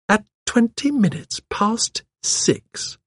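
put-on high, slow, pointed old lady; genteel, measured high voice; old lady sleuth. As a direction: lay out the clue deliberately.

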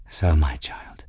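low, resigned admission; subdued, burdened voice; heavy, weary. A narrator's reading. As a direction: admit it low and wearily. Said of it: "His tone was heavy, weary".

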